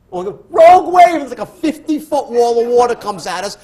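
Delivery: loud, high dramatic telling. Real, not acted. Speaker telling host of the wave, loud dramatic build. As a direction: paint the giant wave with excitement.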